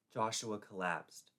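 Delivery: ordinary delivery, nothing marked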